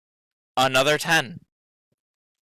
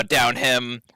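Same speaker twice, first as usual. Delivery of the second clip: ordinary talk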